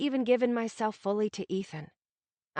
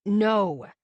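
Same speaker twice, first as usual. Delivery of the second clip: angry; 8 dB louder than usual. A narrator's reading. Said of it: "he said angrily".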